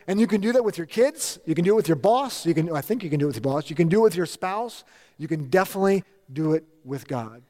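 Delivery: usual speaking voice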